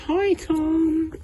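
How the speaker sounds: high, friendly greeting; lightly voiced cheerful guard. Guard greeting Tom, high and friendly. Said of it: "She was like".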